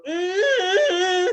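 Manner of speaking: high, loud ecstatic crowd cry; imitated crowd squeal. Co-host mimics the crowd's reaction, high ecstatic squeal. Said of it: "they go".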